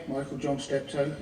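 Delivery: usual speaking voice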